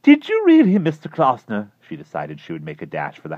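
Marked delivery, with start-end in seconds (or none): 0.0-1.7 much higher, loud, startled; nervous lady. Acted. Mrs Saunders answering the strange man, nervous. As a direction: answer in a flustered hurry. Neighbor edging away.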